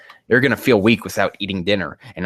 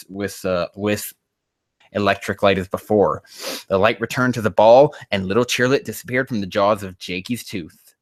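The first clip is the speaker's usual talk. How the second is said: ordinary talk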